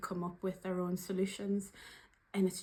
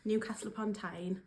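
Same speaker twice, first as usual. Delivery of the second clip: usual speaking voice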